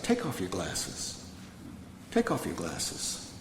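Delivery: low, slow, quiet command; low-voiced, unhurried instruction; stern sponsor. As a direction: imitate a sponsor's firm order, dropped low and drawn out. Sponsor confronts him, retold.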